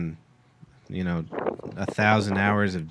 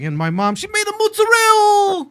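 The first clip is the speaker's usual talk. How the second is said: put-on much higher, louder Italian American accent; mock Italian American; 6 dB louder than usual. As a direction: squeal it like a proud Italian American. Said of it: "And then he's like".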